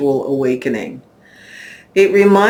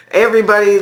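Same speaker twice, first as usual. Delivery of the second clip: higher, louder word through a laugh; 6 semitones higher, 6 dB louder, much faster than usual. Real, not acted. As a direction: laugh your way back into addressing everyone. Laughing after the name slip.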